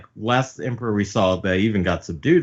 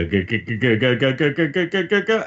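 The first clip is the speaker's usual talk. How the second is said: repeated guttural "g" climbing higher and higher; terrified stammer; 5 semitones higher than usual. Acted. The biggest goon dumbstruck by fear, rising stammer. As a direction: stutter upward as fear mounts.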